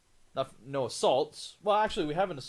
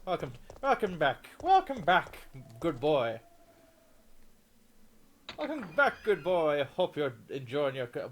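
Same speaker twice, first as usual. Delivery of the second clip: loud, lilting Irish greeting; hearty Irish accent, pitch leaping up; accidental Irish accent; 3 semitones higher, 5 dB louder than usual. Pretend. Streamer greeting a viewer for the holidays, put-on Irish accent. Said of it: "why am I sounding Irish?".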